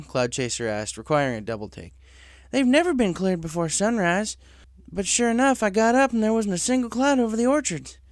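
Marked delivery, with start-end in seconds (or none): 2.5-8.0 higher, lively farm-pony voice; Applejack voice. Acted. Applejack tells Cloud Chaser her orchards were already clear. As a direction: tell it with amazement.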